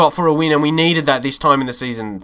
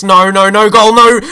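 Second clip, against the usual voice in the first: despairing cry; 6 semitones higher, 7 dB louder than usual. Real, not acted. Watching the late goal go in.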